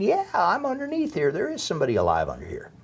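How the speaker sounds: high mock yell at moderate volume, falling away; retold yelling. Trapped survivor answering rescuers, retold yelling. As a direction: re-enact calling up to rescuers, starting high then dropping. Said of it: "and I'm yelling".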